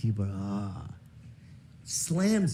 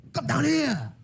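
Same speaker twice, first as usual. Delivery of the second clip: high summoning call, imitating dad.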